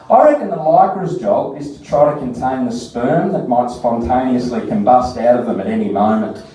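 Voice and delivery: ordinary talk, nothing marked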